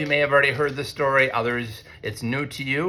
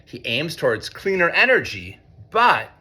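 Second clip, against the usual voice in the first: storybook rhyme, pitched higher and brighter; reading to children; 5 semitones higher than usual. A narrator's reading. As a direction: lift the rhymes for a young audience. Reads picture book to students.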